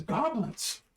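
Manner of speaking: high, sudden cry of alarm; alarmed exclamation. Acted. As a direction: blurt it out high, as a warning. Innkeeper's friend exclaims at sighting.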